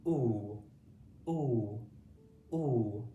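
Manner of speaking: a long "Ooh" repeated for pupils to copy; drilling the oo sound. Shown as a demonstration. Teacher leads pupils in practising the oo sound, drilled. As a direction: hold "Ooh" round and long each time. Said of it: "Say it with me".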